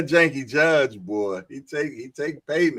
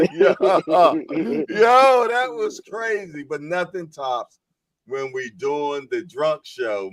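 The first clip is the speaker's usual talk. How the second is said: usual speaking voice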